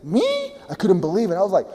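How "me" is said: disbelief. Retold or imitated. Told he's an amazing leader.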